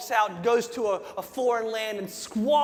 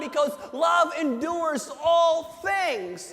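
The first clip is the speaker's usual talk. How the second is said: preaching at full force; 4 semitones higher than usual. Acted. Pastor retelling the prodigal son to his church, shouting with fervor. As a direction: preach it big, like you're lifting the whole room.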